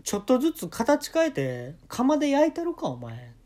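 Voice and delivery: hoog en luid nagespeeld dreigement; dreigende tirade. Retold or imitated. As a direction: dreig hamerend als een doorgedraaide vader. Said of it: "普通に怖いな".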